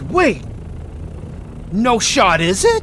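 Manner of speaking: loud, high disbelieving shout; shocked disbelief. Real, not acted. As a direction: yell it in sudden shock. Shocked at the date.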